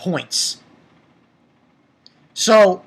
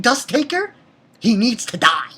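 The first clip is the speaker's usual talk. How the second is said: ordinary delivery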